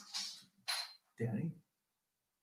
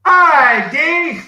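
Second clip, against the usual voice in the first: excited greeting; 8 semitones higher, 6 dB louder than usual. Real, not acted. Calling out a greeting.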